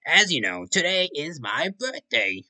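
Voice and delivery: high, loud proud announcing; excited announcement. Acted. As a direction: announce it grandly. Klaus announcing his birthday.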